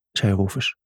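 ordinary delivery

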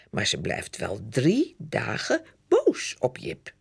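ordinary delivery

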